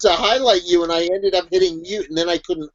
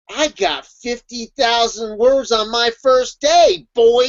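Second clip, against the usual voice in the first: drawn-out, higher-pitched boast; mock bragging; 5 semitones higher, much slower than usual. Writer bragging about first-day word count, mock boastful. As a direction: crow it slowly, stretching the last word. Acted brag in a top ten list.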